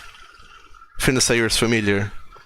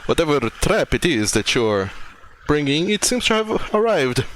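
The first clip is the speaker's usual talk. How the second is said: lilting, higher-pitched character voice; Finasair; 4 semitones higher than usual. Acted. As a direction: swoop up high on key words. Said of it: "And Finasair says".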